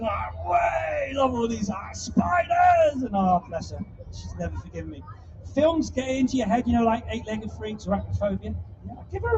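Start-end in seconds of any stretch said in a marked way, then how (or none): 0.0-3.0 hammed-up scream, high; mock scream. Sister shrieks at the fake spiders. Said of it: "she comes into the kitchen".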